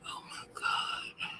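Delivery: soft whisper while looking; whispering to herself. Real, not acted. Streamer whispering to herself while searching the app. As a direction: breathe the words to yourself.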